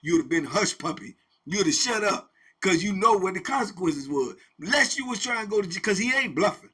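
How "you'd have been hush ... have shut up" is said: ordinary delivery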